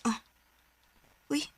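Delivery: ordinary talk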